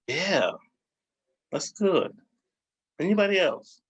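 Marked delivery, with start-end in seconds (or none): none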